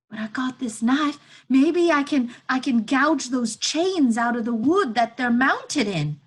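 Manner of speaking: put-on loud, high boy's voice, insistent; eager, desperate boy. Boy offering to gouge out the chains, eager and desperate. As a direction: push the idea out loud and high. Elijah proposes freeing her.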